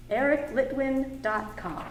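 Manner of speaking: usual speaking voice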